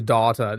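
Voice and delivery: data as "dah-ta"; how they say it. Retold or imitated. Streamer mocking what they say, data as "dah-ta". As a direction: say data with a long "ah". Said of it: "that's what they say".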